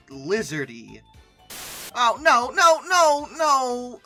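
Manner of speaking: usual speaking voice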